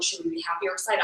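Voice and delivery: low, soft imitation of puzzled friends; hesitant question. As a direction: ask it timidly, under your voice. Friends' puzzled reaction acted out.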